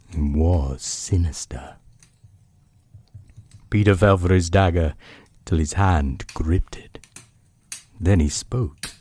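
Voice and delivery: hushed, unhurried bedtime reading; story narration. A narrator's reading. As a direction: read it like a book by the bedside. Reads bedtime chapter aloud.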